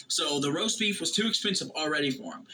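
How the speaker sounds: normal talking voice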